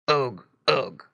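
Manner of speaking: mock piggy grunting; pig grunts. A narrator's reading. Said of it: "grunted Squinty".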